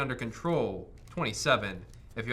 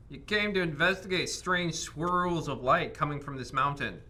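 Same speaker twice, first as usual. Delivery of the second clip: dramatic narration; 4 semitones higher, 4 dB louder than usual. Acted. Game master scene setting.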